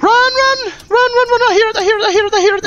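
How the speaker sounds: high frantic screaming in panic; panicked yelling. Real, not acted. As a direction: shriek it, terrified and running. Panicked fleeing from monster.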